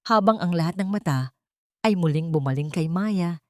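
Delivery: plain reading voice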